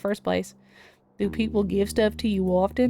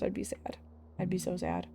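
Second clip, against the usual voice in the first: mock sad whisper; 11 dB quieter than usual. Mock grief over lost sandwich.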